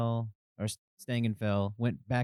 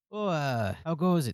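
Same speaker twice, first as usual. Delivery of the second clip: higher, louder character greeting; guard's greeting; 6 semitones higher, 4 dB louder than usual. Acted. Guard greets the approaching party, higher character voice. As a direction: greet them in character, pitched up.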